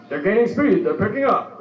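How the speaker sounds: a light imitation of an excited race narrator; horse race announcer. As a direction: call it like a racetrack announcer. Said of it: "the dudes who narrate the race".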